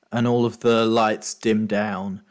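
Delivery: ordinary talk, nothing marked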